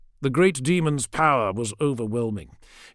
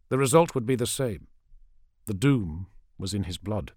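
plain reading voice

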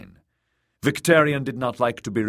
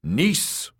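higher-pitched commanding call; 7 semitones higher than usual. A narrator's reading. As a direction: summon her with one sharp word. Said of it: "he called in a commanding voice".

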